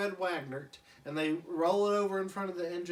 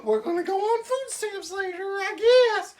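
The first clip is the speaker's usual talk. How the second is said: much higher, louder ranting shout; ranting wife; 9 dB louder than usual. Retold or imitated. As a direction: tear into the husband. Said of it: "And she's like".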